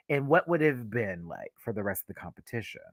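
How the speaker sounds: ordinary talk, nothing marked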